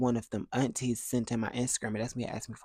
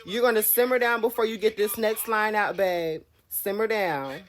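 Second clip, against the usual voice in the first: firm scolding; 8 semitones higher than usual. Retold or imitated. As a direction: lay down the law, firmly. Scolding the uncle firmly.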